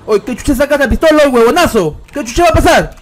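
hammed-up furious outburst; angry shout. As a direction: yell at him for pulling a gun. Gunman pulls a pistol in game.

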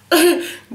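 high bright burst of laughter; real laugh. Real, not acted. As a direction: laugh at yourself. Laughing at spitting while talking.

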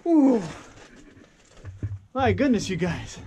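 thrilled exclamations, high and loud; bursting with excitement; excited. Real, not acted. Excited at finding cool mine.

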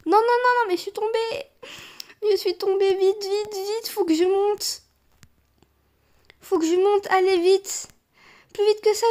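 high-pitched, frantic pleading; panicked urging. Real, not acted. Player fallen while fleeing the tsunami, panicked urging. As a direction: hurry yourself along in rising panic.

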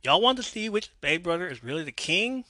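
half-yelled promo question; yelling to crowd. A narrator's reading. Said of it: "yells into his mic, addressing the crowd".

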